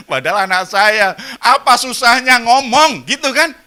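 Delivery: loud, high, driving sermon voice; full-force preaching. Acted. Preacher driving home his point to the congregation, loud and high.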